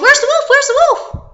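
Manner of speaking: high, loud urgent questions; frantic high-pitched search cry; alarmed villagers shouting. Acted. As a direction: call out urgently as you come running. Villagers arrive running, alarmed.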